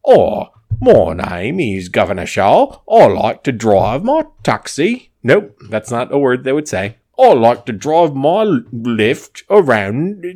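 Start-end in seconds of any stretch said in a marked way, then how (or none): 0.0-5.1 slow, loud, swooping British accent; hammed-up British cabbie introducing himself, vowels stretched; second British accent. Shown as a demonstration. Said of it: "Two is like this". 7.2-10.2 high, very slow hammed-up British accent; second British accent. Shown as a demonstration. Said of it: "Two is like this".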